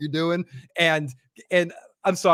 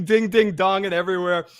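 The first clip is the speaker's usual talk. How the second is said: normal talking voice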